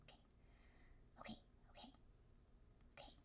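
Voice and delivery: a quick soft whisper. Acted. GM whispering okay to himself. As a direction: whisper it under your breath.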